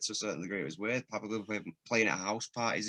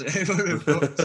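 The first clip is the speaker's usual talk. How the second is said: loud, higher, breaking into laughter; laughing while talking; 9 semitones higher, 10 dB louder than usual. Real, not acted. Guest admitting his escape plan was doomed, laughing. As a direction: laugh through the admission, voice lifting.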